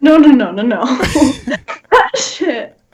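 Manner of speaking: usual speaking voice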